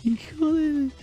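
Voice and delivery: falsetto cry; high squeaky exclamation. Acted. (grito agudo)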